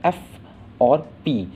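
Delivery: एफ and पी said slowly with force; एफ और पी firmly and slowly; stressed letters. Shown as a demonstration. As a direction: lean hard on एफ and पी. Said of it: "आपको ज़ोर लगाकर बोलना पड़ेगा इन्हें".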